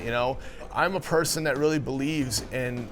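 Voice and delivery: ordinary delivery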